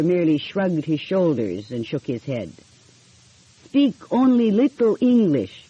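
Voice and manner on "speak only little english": put-on light Italian accent, raised a touch; a hint of an Italian tailor's broken English; lightly voiced Italian accent. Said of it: "he said with an Italian accent".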